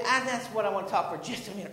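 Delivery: ordinary talk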